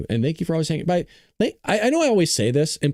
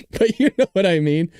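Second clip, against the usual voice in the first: laughing; 8 semitones higher, 4 dB louder than usual. Real, not acted. Laughing at own impression.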